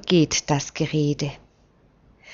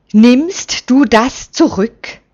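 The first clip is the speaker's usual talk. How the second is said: cold threat, higher and louder; icy demand; 5 semitones higher, 6 dB louder than usual. A narrator's reading. Simeon grabs Usiel by the robe, icy threat. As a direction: demand it back with icy menace. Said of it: "klirrt es eisig".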